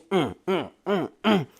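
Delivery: short punching grunts; imitated exertion grunts. Storyteller acts out serving him, imitated exertion grunts. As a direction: grunt once per imagined blow.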